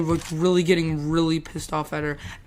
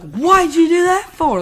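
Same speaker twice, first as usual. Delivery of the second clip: high, loud retold demand; hammed-up exasperated yell; mock pissed off; 11 semitones higher, 7 dB louder than usual. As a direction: imitate an angry teacher, pitch shooting up. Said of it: "she's kinda pissed now".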